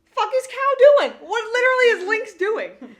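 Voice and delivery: loud, high incredulous shout; outraged yell. Real, not acted. Podcaster yelling in disbelief at the show's scene, outraged shout.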